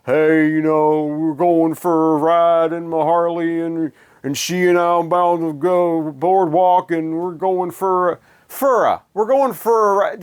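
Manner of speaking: laid-back mock slacker drawl, slowed; loafing rocker bragging about his ride; lazy put-on Jersey slacker talk. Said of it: "like, slacker kinda".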